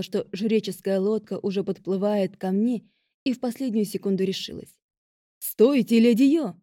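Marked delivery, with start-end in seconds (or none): 5.4-6.6 громкий окрик; крикни, чтобы остановить. A narrator's reading. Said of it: "закричал кто-то в лодке". (громкий окрик издалека)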